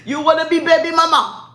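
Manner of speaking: high, loud mock Nigerian accent; Pookie. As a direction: scold in an imitated Nigerian accent. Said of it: "That's what he told me in the car".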